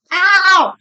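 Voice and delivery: sharp real yelp of pain; sudden ow. Real, not acted. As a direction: yelp when the puppy scratches. Puppy scratches her.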